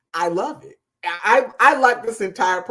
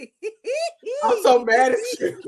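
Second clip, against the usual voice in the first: high, slow words through laughter; real laughter breaking over the words; laughing; slower than usual. Real, not acted. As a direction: crack up while playfully complaining. Co-host cracks up, playful complaint.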